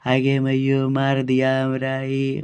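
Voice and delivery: slow, droning fake wail; mock crying. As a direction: imitate a daughter-in-law's theatrical sobbing complaint, drawn out. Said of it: "अब घर में रोने लगी". (रोने की नाटकीय नकल, धीमे खींचकर)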